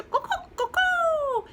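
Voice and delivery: high loud caws like a rooster; rooster crow. A narrator's reading. Rooster crows at the big chicken, high cawing. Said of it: "crowed the rooster".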